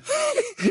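high nervous little laugh; a jittery burst of laughter; nervous giggle. A narrator's reading. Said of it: "Ron eut un rire nerveux".

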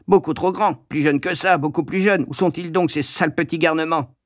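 high, croaking cross shopkeeper; Madame Pratchett. A narrator's reading. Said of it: "entendis-je Madame Pratchett coasser". (mégère qui coasse, voix aiguë)

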